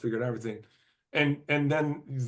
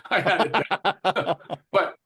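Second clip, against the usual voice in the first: laughing; 7 semitones higher, 8 dB louder than usual. Real, not acted. Laughing while recalling nerves.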